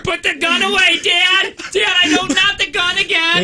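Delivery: put-on very high squealing kid begging; mock terrified kid. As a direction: squeal like a panicked child for the neighbours. Mock child pleading with dad.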